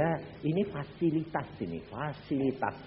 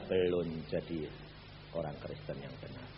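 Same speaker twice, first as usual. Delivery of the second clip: lower, softer solemn reply; voice of God; 9 semitones lower, 9 dB quieter than usual. Retold or imitated. God answers the proud man in a low, soft voice. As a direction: answer quietly and gravely. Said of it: "Tuhan bilang".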